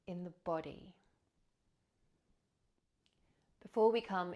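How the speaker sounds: measured, spacious breath-practice narration; guided meditation. Acted. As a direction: guide listeners gently into the breath, leaving space between phrases.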